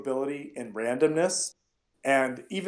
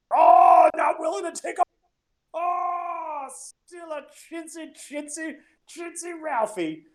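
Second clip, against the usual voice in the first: much higher, shouted mocking jeer; lazy-eyed heckler; 5 dB louder, slower than usual. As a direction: taunt him like a sneering barfly, high and loud. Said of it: "you kinda hear the guy with the lazy".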